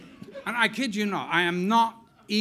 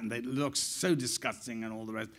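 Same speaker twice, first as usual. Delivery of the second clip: ordinary delivery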